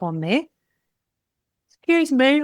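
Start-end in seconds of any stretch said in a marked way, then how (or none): 1.7-2.4 yawning. Real, not acted. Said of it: "always yawning in the afternoon".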